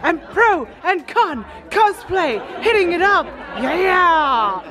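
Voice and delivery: high excited calling out; hype shout. Real, not acted. Backstage cheering on cosplay friends, high hype calls.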